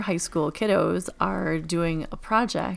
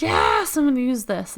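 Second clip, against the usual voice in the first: higher, louder eager reply; high mock-eager announcement; excited colleague; 7 semitones higher, 5 dB louder than usual. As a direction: belt it with delight. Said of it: "So she was like".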